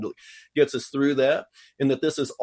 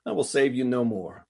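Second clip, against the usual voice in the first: mild, easygoing delivery at normal pace; nice, casual; 3 dB quieter than usual. Shown as a demonstration. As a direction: say it nicely, with no weight on it. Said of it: "nice and just kinda say it like".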